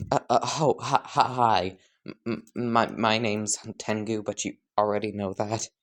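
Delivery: nervous stuttering, tripping on words. Acted. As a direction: stammer through it nervously. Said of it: "you are a stuttering mess".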